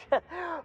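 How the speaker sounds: quick high nervous giggle; a jittery little laugh. Acted. Nervous mascot caught rifling belongings.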